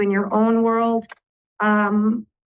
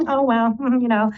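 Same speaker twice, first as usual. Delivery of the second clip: gleeful chuckling; 3 semitones higher than usual. Acted. Astrologer voicing a listener watching karma served, gleeful chuckle. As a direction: giggle lightly as if enjoying someone's comeuppance. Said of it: "you're sitting back gleefully going".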